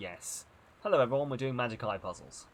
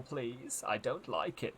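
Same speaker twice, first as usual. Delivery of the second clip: ordinary delivery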